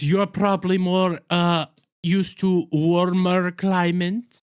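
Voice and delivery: lightly voiced, slow mock German accent; bad German accent. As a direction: drawl it out slowly in a hammy fake German accent. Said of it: "That is a line in the show".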